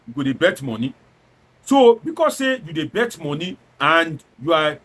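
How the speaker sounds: ordinary talk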